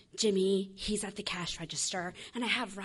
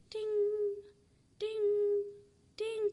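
high, slow sung dings; imitated bell; 12 semitones higher, much slower than usual. Mimicking the drive-through bell ringing, high dings.

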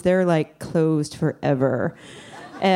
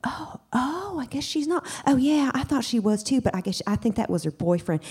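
high, hushed voices of two women; high and quiet overheard gossip; whispering gossipy ladies; 7 semitones higher, 5 dB quieter than usual. Retold or imitated. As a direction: imitate women gossiping softly. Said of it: "I now can hear the ladies".

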